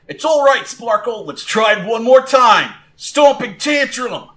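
high, loud trainer's rallying call; rally your Pokemon for another try; encouraging shout. Acted. Trainer urges Sparkle to retry, calling out.

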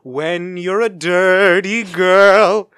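slow, big Disney voice; Disney movie voice. Retold or imitated. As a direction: stretch it out like a Disney opener. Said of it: "the Disney movie".

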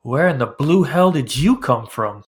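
loud, slow fisherman's voice; weathered fisherman. Acted. Weathered fisherman looks over at the swimmers, loud slow demand. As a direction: play a fisherman calling out, loud and drawn out. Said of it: "typical weathered fisherman".

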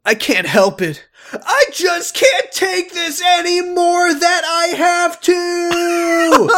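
very high slow shout; shouting character. Acted. Jacob raging voiced high.

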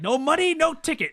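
high, loud comic refusal; mock fury. Ticket seller refuses the broke kids, mock furious yell. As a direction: yell it like a clerk losing it. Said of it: "Instantly loses it".

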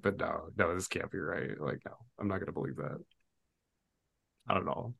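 hushed, retold self-doubt; muttered denial. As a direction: talk yourself out of it under your breath. Voicing characters' doubtful self-talk.

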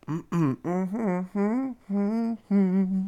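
soft, high contented hums; little high-pitched mm-hmms; high humming. Real, not acted. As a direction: hum softly up high while sipping. Sips coffee, making high hums.

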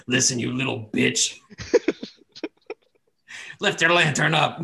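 laughing, gruff divine command; gruff god voice. Retold or imitated. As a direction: bark orders as a crude god. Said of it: "Illuminator just coming to Anton like".

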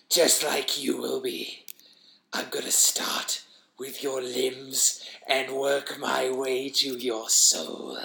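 slow, labored monster rasp; menacing wheezing creature. Acted. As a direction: threaten slowly through a ruined throat. Creature threatens the narrator.

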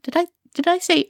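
high, rushed stammer; caught-out, panicky fluster. Acted. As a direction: backpedal quickly after a slip. Caught out saying the wrong name.

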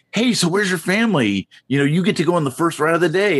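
lightly imitated upbeat staffer, high pitch; high-pitched welcome, speeding up through the offer; park staff greeting. Park staff greeting a chosen guest.

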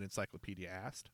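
usual speaking voice

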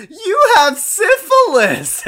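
drawn-out squealing taunt; mocking voice. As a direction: shriek it slowly like a taunting crowd. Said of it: "doing their voice".

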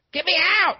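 high, loud panicked singer impression; hammed-up high cry for rescue. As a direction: yell it in a squealing falsetto. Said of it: "He's doing his [name] voice".

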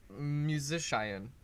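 musician deliberately drawn out and misspoken; playful mispronunciation. Pretend. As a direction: say musician slowly, as if unsure of the word. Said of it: "am I pronouncing that right?".

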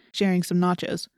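ordinary delivery

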